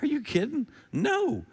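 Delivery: high, incredulous mock disbelief; a hammed-up are-you-kidding reply; incredulous Paul. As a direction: scoff like the question is absurd. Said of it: "Paul goes".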